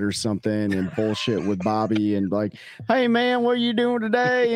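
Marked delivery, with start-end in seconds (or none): 2.9-4.5 put-on Southern accent. Ramp loafer chatting with Bobby, Southern accent. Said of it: "sorry to throw that Southern accent out there".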